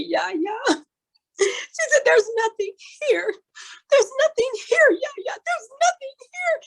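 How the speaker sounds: put-on high, sobbing wail of a disappointed little girl; imitated crying child. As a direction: cry it out like a heartbroken child, pitched high. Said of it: "she literally started crying".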